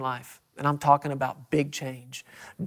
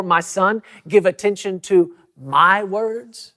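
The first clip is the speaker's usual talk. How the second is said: "give attention to my words" higher and louder, slowing onto a drawn-out MY; stress on MY; 4 semitones higher, 4 dB louder, slower than usual. Shown as a demonstration. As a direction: lean hard on "my" before "words". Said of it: "with the emphasis here".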